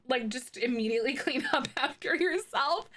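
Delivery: ordinary delivery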